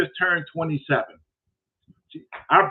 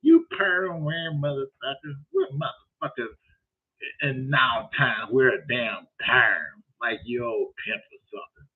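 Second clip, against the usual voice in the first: slow, lightly put-on insulting drawl; a hint of a slow-talking heckler; imitating the caller; 4 semitones lower, slower than usual. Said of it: "this is how he talked".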